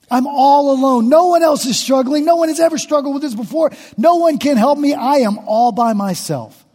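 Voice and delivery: David crying out in isolation to God; anguished David. Acted. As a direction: wail that no one can help. Preacher voices David's lonely lament.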